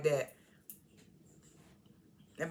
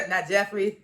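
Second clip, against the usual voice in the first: real laughter breaking into the words; laughing; 6 dB louder than usual. Real, not acted. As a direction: crack up at your own aside. Laughs at her own joke.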